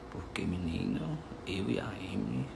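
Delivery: soft, hushed, so she won't hear; speaking softly. Real, not acted. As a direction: keep it down, she's right there. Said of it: "me fala bem baixinho".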